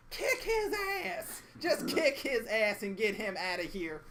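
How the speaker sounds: put-on high, slow old lady cheering; granny yelling. Granny at the counter urging him on, high old lady shout. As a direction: egg him on like a feisty granny, high and drawn out.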